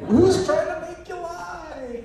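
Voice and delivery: high, slow, incredulous question; mock baffled executives. Executives to the Bigfooter, high and incredulous. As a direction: play the baffled suits, drawing it out. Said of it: "And they're going like".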